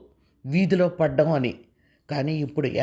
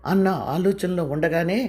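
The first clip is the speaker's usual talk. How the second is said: ordinary delivery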